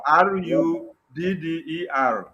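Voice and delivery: slow, letter by letter; deliberate spelled-out R U D D E R; spelling out. Shown as a demonstration. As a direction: spell RUDDER slowly, each letter apart. Spelling out the disputed word.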